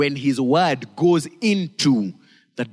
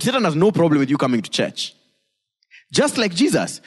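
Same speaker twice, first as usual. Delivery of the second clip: ordinary delivery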